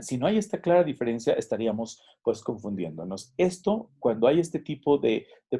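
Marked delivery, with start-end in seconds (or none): none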